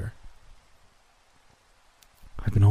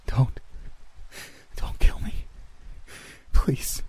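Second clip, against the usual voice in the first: whispered, trembling plea; terrified whisper; 5 semitones higher, 5 dB quieter, slower than usual. Acted. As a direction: beg for your life in a whisper. Begging the ghost for life.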